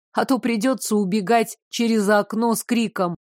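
ordinary delivery

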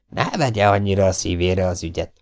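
usual speaking voice

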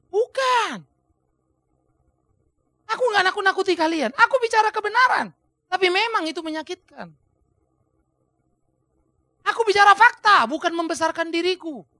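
high, impassioned rebuttal; Paulus. Acted. Preacher as Paul denying he frightens the church, impassioned high protest. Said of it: "oleh karena itu dia mengatakan".

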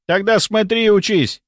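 high, urgent command to a junior; stern retort. A narrator's reading. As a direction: put a fumbling junior in their place. Doctor snaps at fumbling nurse.

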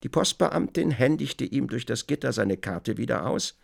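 ordinary talk, nothing marked

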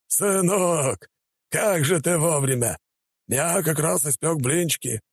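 high, jolly dad voice; beaming, welcoming dad; cheerful father. A narrator's reading.

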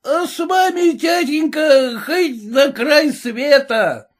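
high, slow eager cheer; eager son. Acted. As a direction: cheer like a reckless young son.

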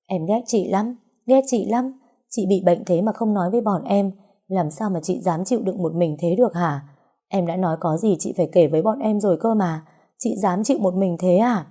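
usual speaking voice

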